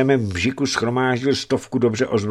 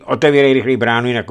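shouting; 5 semitones higher, 8 dB louder, faster than usual. Acted. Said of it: "rozkřikl se na něj Žarený".

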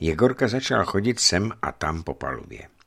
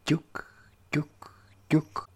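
whispered knock; 9 semitones higher, 4 dB quieter, much slower than usual. Acted. Imitating knocking sounds.

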